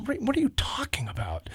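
high confused whisper. Retold or imitated. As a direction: whisper the question in disbelief. Confused question retold.

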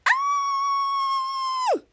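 high-pitched horror-movie shriek; mock scream. Host imitates the TV mom screaming, high shriek. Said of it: "the mama Annie screams".

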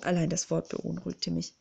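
plain reading voice

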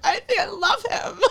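high-pitched mock-drunk whine; drunken confession. Imitating drunk confessions to a best friend, whiny and high. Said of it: "like, really drunk".

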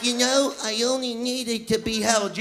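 a lightly acted clingy plea; mock needy woman. Woman to her one-night stand next morning, mild plea. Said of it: "they're like".

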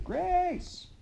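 high stretched-out shout. A narrator's reading. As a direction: shout the name across the prairie. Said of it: "And Pa shouted too".

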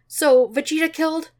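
a hint of Bulma asking in disbelief; Bulma's voice. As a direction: lift it up into a surprised woman's voice. Bulma's voice in dialogue.

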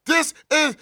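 slow, high, drawn-out announcer call; hype announcer. Acted. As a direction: stretch it out like a hype host. Announcer-style show opener.

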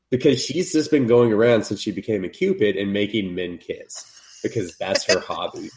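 normal talking voice

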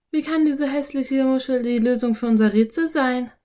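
put-on high, baffled girl character voice. A narrator's reading. Anne doubts the ugly shell solves it, baffled high voice. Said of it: "sagte Anne verblüfft".

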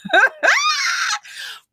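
high shrieking laugh that cracks like a cry. Real, not acted. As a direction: let out a wild screaming laugh. Laughing shout after rebuke.

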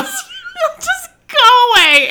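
high, loud laughing line. Real, not acted. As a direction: laugh it out, nearly a shout. Laughing at own habit.

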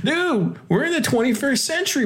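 high laughing whoa, mock outrage; 1 mock shock. Host scolds the soundboard voice with laughing mock shock. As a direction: act scandalised for fun.

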